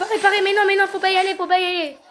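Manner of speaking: high, loud laughing protest; excited warning. Real, not acted. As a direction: laugh while urging them to stop.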